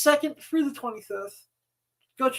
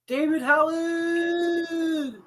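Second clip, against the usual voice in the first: long, drawn-out hype shout; announcer shout; 4 semitones higher, much slower than usual. Acted. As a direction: stretch the name like a ring announcer. Hyping up the guest's introduction.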